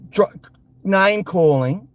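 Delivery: ordinary delivery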